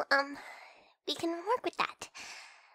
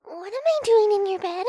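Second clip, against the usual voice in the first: alarmed outcry; 5 semitones higher, 11 dB louder than usual. Acted. Realizes it's not their bed.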